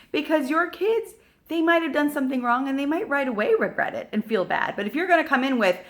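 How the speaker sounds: ordinary talk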